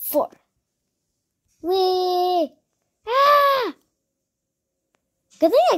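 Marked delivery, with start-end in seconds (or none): 1.6-2.5 high, loud playful whee; excited ride squeal; gleeful whee. Real, not acted. Riding down in game. 3.1-3.7 very high, loud shriek; sudden high scream; startled scream. Real, not acted. Falling in game.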